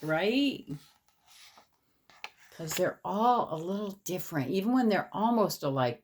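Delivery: ordinary delivery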